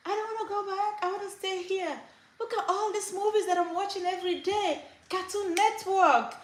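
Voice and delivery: put-on very high, insistent child voice; five-year-old boy. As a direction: plead like a little boy who loves cartoons. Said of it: "five at the time".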